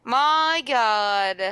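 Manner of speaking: loud groaning dismay; real dismay at a bad roll, said loud; dismayed exclamation. Real, not acted. The player reacts to rolling a ten, dismayed and loud. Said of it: "reacts to bad roll".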